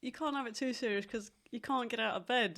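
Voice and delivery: ordinary talk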